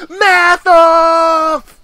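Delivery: high, loud, long-held announcing yell; hammed-up battle cry. Bystander calling out a maths duel, hammed-up yell. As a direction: bellow the contest open, pitched right up.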